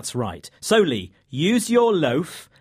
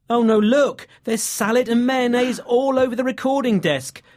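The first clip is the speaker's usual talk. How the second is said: alarmed surprise; 7 semitones higher, 4 dB louder than usual. Real, not acted. Spots mess on recording desk.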